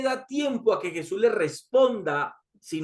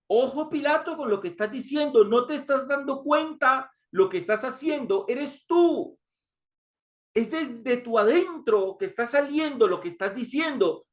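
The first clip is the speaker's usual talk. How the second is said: lightly voiced Jesus, higher and urgent; a gentle warning, pitched up; Jesus warning Pilato; 6 semitones higher than usual. Jesus warns Pilato about his own words, mildly urgent and higher. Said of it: "Es como si le estuviera diciendo".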